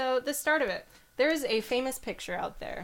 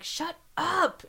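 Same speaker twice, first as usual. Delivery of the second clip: retold shut up; 8 semitones higher, 3 dB louder than usual. Sister snaps at Janet.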